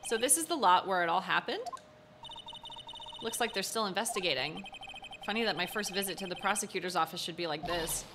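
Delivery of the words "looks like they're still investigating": ordinary talk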